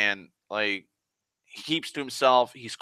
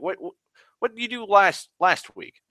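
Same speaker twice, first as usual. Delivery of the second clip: inquisitive man; 10 semitones higher, 3 dB louder than usual. Retold or imitated. Voicing man questioning the worker.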